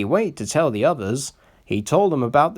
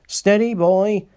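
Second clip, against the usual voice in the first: high, louder steadying call; driver warning; 9 semitones higher, 5 dB louder than usual. A narrator's reading. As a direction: rein him in firmly. Driver checks the engine.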